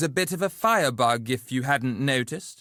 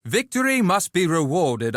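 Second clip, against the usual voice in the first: normal talking voice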